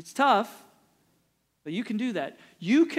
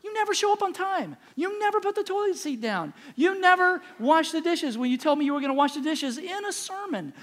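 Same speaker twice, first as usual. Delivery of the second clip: much higher, mock-whiny complaining spouse; nagging never; 8 semitones higher than usual. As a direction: parody a nagging partner's list. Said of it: "or never".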